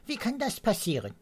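high, fast puzzled bird voice; bewildered cry. A narrator's reading. Mrs Swallow wonders at the snow, high bewildered cry. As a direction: rush it out in disbelief. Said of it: "rief Frau Schwalbe".

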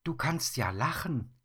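light up at the smiling bear; pleased surprise. Acted.

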